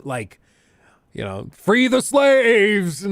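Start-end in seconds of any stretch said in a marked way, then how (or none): 1.5-3.1 hammed-up battle cry, high, loud and stretched; mock scream. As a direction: imitate an actor screaming a rallying cry. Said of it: "screaming at dinner".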